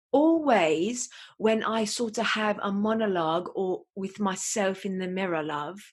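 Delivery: put-on British monologue voice; British accent. Telling listeners about talking to the mirror, in a British accent. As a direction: chat in an affected British accent. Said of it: "I speak in a British accent".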